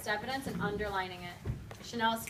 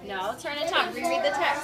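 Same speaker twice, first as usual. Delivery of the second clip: firm correction; 4 semitones higher, 6 dB louder than usual. Real, not acted. Teacher redirects a student.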